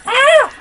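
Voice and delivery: sudden shrill yelp of pain; high-pitched scream. Real, not acted. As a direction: scream high when taking damage. Hit to half a heart.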